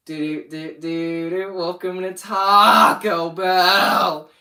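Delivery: doot-doot intro building to a loud yell; sing-song lead-in, then a long goofy shout; hammed-up jingle announcement. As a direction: belt out a mock fast-food welcome, stretching the last words. Performs jingle from own old video.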